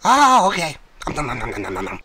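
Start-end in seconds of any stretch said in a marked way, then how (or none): 1.1-2.0 low nom-nom munching; mock eating sounds; munching noises. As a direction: imitate greedy chomping, low.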